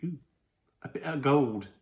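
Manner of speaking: a terrible put-on English accent; hammed-up English accent, proud of the find; bad English accent. Shown as a demonstration. Tasting Irish toffee on camera, in a bad English accent. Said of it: "it was a terrible English accent".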